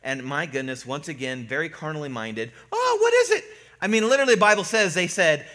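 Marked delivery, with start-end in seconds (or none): 2.7-3.4 high-pitched, loud startled cry; mock fright. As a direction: imitate panicked disciples, played for laughs. Disciples startled by figure on lake.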